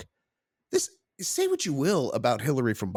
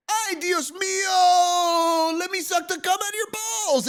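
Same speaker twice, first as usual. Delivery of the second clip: very high, wailing mock Spanish accent. Mocking wife distracting husband, in shrieky fake Spanish accent. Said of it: "and she'd be like".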